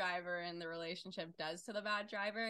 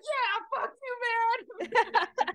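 much higher, loud yell; excited yell; 14 semitones higher, 10 dB louder, much slower than usual. Retold or imitated. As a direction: shout it out the window, thrilled. Flipping off slow driver.

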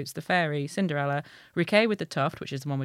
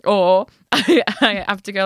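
normal talking voice

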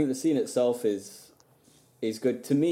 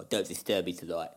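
lower, softer put-on mob-boss accent; mock Stalin accent; 4 semitones lower, 6 dB quieter than usual. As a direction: growl the order like a Soviet boss, lower and softer. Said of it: "I might keep doing that".

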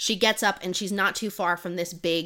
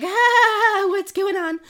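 high, loud startled yelp; frightened cry; 13 semitones higher, 7 dB louder, slower than usual. Retold or imitated. Rosalina attacked by goblins in the woods, panicked high cry.

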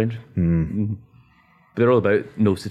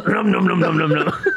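snapping teeth; 9 semitones higher, 3 dB louder than usual. Pretend. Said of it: "That's the noise it makes".

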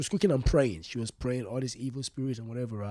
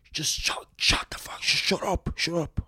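harsh unvoiced whisper of a strict mom; mom hissing shut up; 3 semitones higher than usual. Mom hissing at the crying sister to shut up. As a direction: hiss it through the teeth at the crying child.

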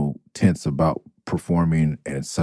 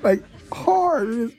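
high, cracking with laughter; trying not to crack up while telling it; giggling through the story; laughing disbelief. Real, not acted. Laughing while recounting prank.

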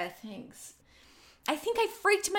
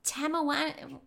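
ordinary delivery

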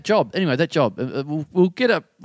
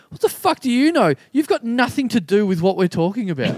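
mock angry snap; 8 semitones higher than usual. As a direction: fling it back like a scornful colleague. Colleagues snapping at a newcomer.

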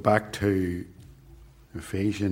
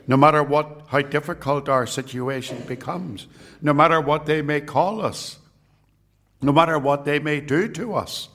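roaring rallying speech; 4 semitones higher than usual. Acted. Rallying climax of sermon.

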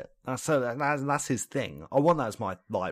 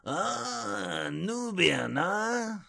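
high, swooping alien trader; Watto; 8 semitones higher, 3 dB quieter, much slower than usual. Acted. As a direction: draw it out with big rising and falling swoops. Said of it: "you can bash out a Watto impression".

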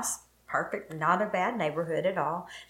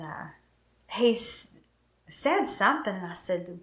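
ordinary talk

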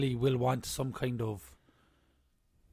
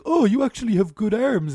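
gushing admirer; 8 semitones higher, 8 dB louder than usual. Retold or imitated. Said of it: "you're almost like".

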